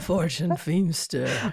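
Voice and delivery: crackly, choked-up rasp; hoarse voice. Real, not acted. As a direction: speak through a lost voice. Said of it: "heard my voice this low".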